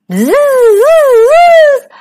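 loud, high angry buzz; long high "zzz"; buzzing bees. A narrator's reading. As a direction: buzz like a swarm attacking. Imitated buzzing of stinging bees.

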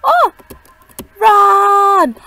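high, loud fast alarm; startled real panic yell. Real, not acted. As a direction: shout a warning to run. Monster appears, yells run.

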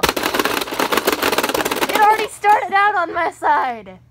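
loud protest. Real, not acted. Face in foam game, shouting.

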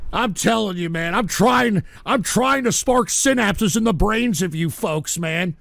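usual speaking voice

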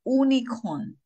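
"unicorn" starting "oo", like "oonicorn"; wrong pronunciation. Shown as a demonstration. Teacher asking do we say unicorn, mispronounced as oonicorn. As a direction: drop the Y sound from "unicorn". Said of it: "If we pronounce".

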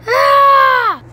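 sudden high yelp; startled shout. Real, not acted. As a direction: yelp in surprise. Threatened with being hit.

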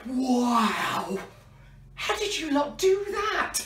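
high-pitched playful astonishment; amazed. Pretend. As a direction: marvel at the children's magic, voice rising high. Amazed that the trick worked.

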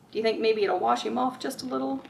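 plain reading voice

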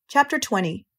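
plain reading voice

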